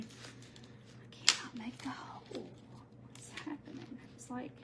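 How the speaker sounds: soft unvoiced whisper to herself; whispered frustration. Real, not acted. Crafter whispering to herself while stuck on the clay. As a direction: whisper it under your breath, stuck.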